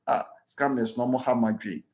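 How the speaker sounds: normal talking voice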